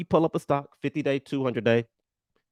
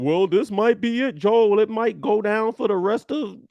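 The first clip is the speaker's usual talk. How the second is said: doubting viewer; 6 semitones higher, 4 dB louder than usual. Retold or imitated. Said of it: "why did I use all the voices".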